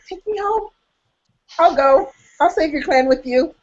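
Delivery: high swooning fan voice; mock swoon. Swooning at the Scottish hero in a high gushing voice. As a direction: gush like a smitten heroine.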